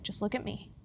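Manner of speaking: gently steady a frightened wife; calm reassurance. Retold or imitated. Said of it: "And he's like".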